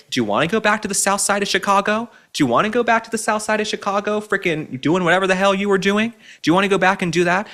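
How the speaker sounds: high, fast sneering questions; mocking Michelle Obama about going home; taunting challenge. Real, not acted. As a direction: needle her with it.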